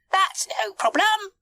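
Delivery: high-pitched, busy little character; high cartoon monkey voice; monkey first mate. A narrator's reading. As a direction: puff it out in a high voice. Mike the monkey.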